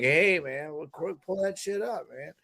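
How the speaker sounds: croaky, low, quiet Rogan voice; lazy croak like a podcast host asking for a clip; a light croaky Rogan impression, pull that shit up; croaky low Joe Rogan. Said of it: "a croaky, low voice".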